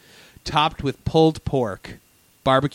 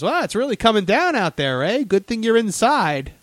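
higher animatronic character voice, faintly Canadian-sounding; lilting imitation with a tossed-in Canadian "eh"; Scuttle; 8 semitones higher than usual. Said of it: "That wasn't really my Canadian voice".

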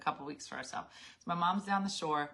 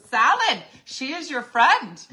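louder and slow, pressing each word of Salad; mock insistent Jurgen; 5 semitones higher, 9 dB louder, slower than usual. Jurgen insisting to the narrator, slow and louder. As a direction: spell it out like it's obvious. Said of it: "He goes".

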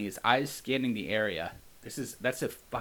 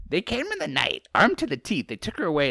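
creature voice; 5 semitones higher, 7 dB louder than usual. Acted. Creature continues its tale.

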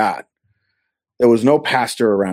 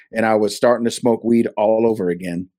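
normal talking voice

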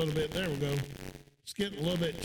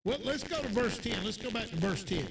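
usual speaking voice

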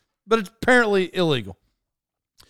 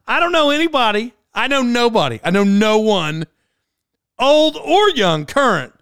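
much higher, louder incredulous rant, slowing down; exasperated rant; 6 semitones higher, 5 dB louder than usual. Real, not acted. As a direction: protest that nobody says it. Exasperated rant about the word.

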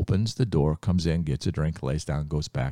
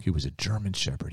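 plain reading voice

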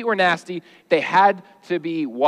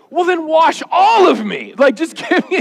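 high, loud and over-eager, pleading; eager Peter; 9 semitones higher, 5 dB louder than usual. Acted. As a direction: blurt it out like an overexcited disciple. Eager Peter in a comic retelling.

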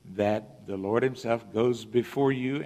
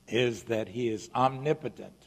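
usual speaking voice

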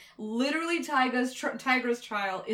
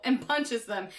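ordinary talk

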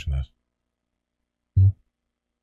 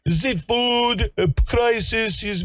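mock official; 12 semitones higher, slower than usual. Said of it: "mocking the official".

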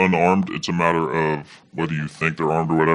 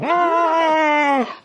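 imitated crowd roar.

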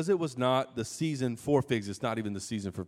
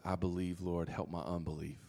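much softer and lower, almost breaking; hushed confession; 6 semitones lower, 9 dB quieter than usual. Retold or imitated. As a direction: confess it barely above a breath. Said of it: "the dad looks at him and says".

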